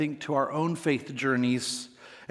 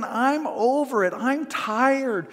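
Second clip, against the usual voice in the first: fed up; 6 semitones higher, slower than usual. Retold or imitated. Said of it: "And he's saying".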